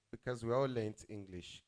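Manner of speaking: normal talking voice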